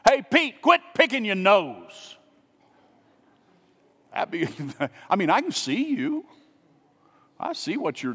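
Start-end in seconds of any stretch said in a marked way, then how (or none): 0.0-2.2 mock yell. Pastor pretends to shout at congregant, mock yell. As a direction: pretend call-out, loud and high, then slowed on the reprimand. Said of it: "I just yelled out from my seat".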